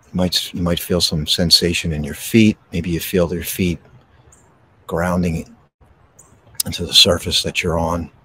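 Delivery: gentle breathing-exercise guidance; guided meditation. Acted. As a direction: ease them into stillness, unhurried.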